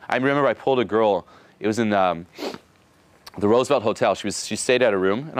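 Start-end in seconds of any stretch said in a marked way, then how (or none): none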